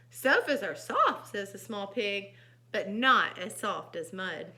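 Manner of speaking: animated reading with loud dialogue; emphatic storytime voice; story narration. A narrator's reading. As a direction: read to children, stretching key words. Reads picture book aloud.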